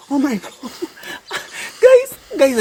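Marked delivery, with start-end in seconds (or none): 1.8-2.6 laughing call. Real, not acted. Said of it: "I feel like crying".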